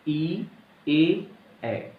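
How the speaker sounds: vowels ई and ए drawn out clearly, tongue forward; vowel demo. Shown as a demonstration. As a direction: say ई, ए, ए slowly and distinctly as a sample. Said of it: "बोल के देखिए एक बार".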